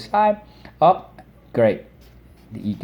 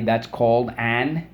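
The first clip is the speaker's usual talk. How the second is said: ordinary delivery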